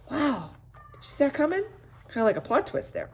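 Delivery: ordinary delivery, nothing marked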